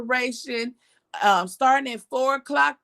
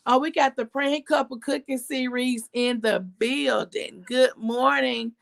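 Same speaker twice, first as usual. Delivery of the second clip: ordinary delivery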